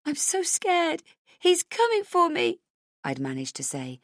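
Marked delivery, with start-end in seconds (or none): 0.0-2.6 put-on much higher small child voice; frightened child. Frightened child to the police chief, high. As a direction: speak as a terrified little girl.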